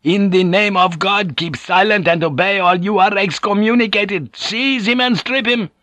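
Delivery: high, ringing, forceful command; avenging fury. A narrator's reading. Superior excommunicating a brother, in righteous fury. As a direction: thunder out the order like an avenging angel. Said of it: "Like an avenging angel".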